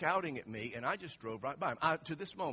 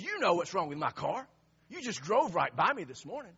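imitating an indignant, louder accusation; angry coworker; 4 semitones higher, 7 dB louder than usual. Angry coworker accusing the man who drove past him, indignant and loud. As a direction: throw the blame back, mock-angry. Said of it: "he's angry with me".

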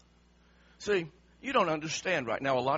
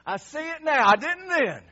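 high, loud, delighted woo; joyful realization; 7 semitones higher, 10 dB louder than usual. Pretend. Believer on resurrection day, loud and overjoyed. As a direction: burst with joy at finally understanding. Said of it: "you're gonna say".

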